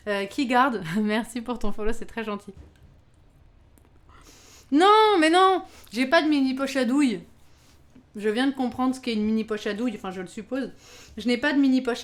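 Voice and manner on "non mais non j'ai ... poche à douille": high, loud cry of protest; loud dismay. Real, not acted. Streamer realising she lacks a piping bag, loud dismay. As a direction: cry out in dismay, then ease off slightly.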